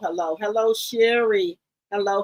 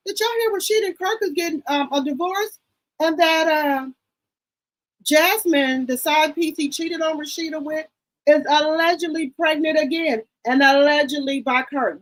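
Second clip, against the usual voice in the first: higher and quicker, eager to spill the tea; breathless gossip, higher and fast; excited gossip; 6 semitones higher than usual. Real, not acted. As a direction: rush out the juicy news, pitch up. Breaking juicy celebrity gossip news.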